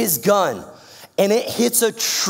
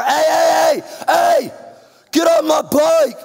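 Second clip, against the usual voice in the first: high, loud furious yell; yelling louder; 9 semitones higher, 6 dB louder, slower than usual. Retold or imitated. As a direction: yell harder at the bike thief. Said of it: "he just starts yelling louder".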